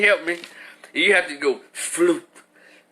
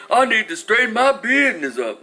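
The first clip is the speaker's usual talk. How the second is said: put-on small kid yelling his line, a bit higher; little boy voice; 3 semitones higher than usual. Substitute teacher imitating a little boy, higher. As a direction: play the tiny kindergartner demanding it. Child voiced in classroom story.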